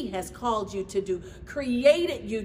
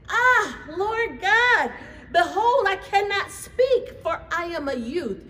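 pleading Jeremiah; 8 semitones higher, 4 dB louder than usual. Retold or imitated. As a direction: whine it up high like a reluctant boy. Jeremiah's pleading reply to God.

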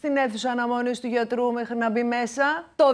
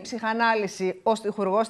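ordinary talk, nothing marked

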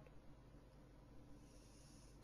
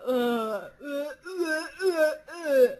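1 mock gagging; slower than usual. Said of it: "and I'm just like".

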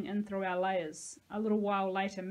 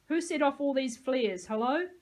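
put-on cross old voice, loud and higher; old but mad; 5 semitones higher, 5 dB louder than usual. A narrator's reading. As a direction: demand an answer, irritated and raised. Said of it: "An old voice, but mad".

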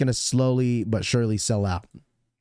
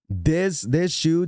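ordinary talk, nothing marked

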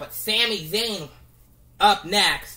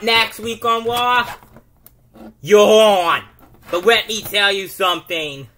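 loud cocky champion taunt; shouted heel promo; 7 dB louder than usual. Pretend. Sami Zayn taunts Rey Mysterio, loud. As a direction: yell back at the challenger.

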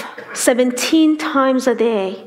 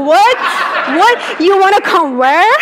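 higher, loud mock disbelief; astonished friend; 6 semitones higher, 5 dB louder than usual. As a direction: act out a friend's stunned double take. Friend's shocked reply to church request.

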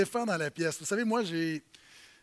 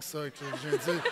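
laughing; 4 semitones lower, 4 dB quieter than usual. Real, not acted. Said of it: "c'était une bonne blague".

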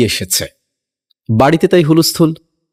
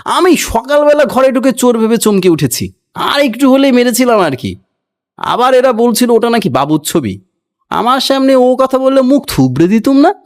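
higher, hammed-up angry servant shout; furious shouting; 8 semitones higher than usual. A narrator's reading. Angry servant rants at the others about the portrait, high and hammed-up. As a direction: rant like a servant who nearly hit a thief. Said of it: "ভীষণ ক্ষেপে চেঁচিয়ে বলল".

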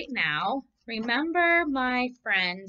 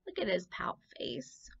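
whisper; 7 dB quieter than usual. Real, not acted. Teacher whispering to children about the sad fish. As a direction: lean in and whisper it. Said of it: "unvoiced whisper".

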